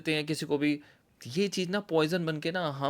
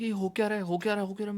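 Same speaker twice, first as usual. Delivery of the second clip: bewildered panic; 6 semitones higher, much faster than usual. Retold or imitated. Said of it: "I was like".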